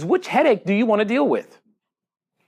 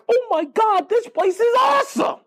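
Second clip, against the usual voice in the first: amazed. Retold or imitated. Telling the audience about Nordstrom's service, thrilled high squeal. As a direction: gush it way up high like a thrilled kid. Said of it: "I'm just like".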